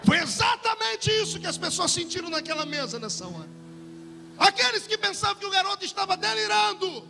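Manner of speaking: high, impassioned pulpit cry; preacher's rousing exclamation; fervent preaching. Real, not acted. Preacher builds to a climax.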